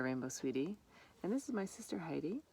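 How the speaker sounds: usual speaking voice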